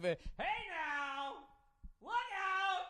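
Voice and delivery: high, far-off hammed-up announcer call. Comic retelling the bar cockblocker, hammed-up announcer call. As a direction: mimic a cheesy sports announcer hollering across the bar.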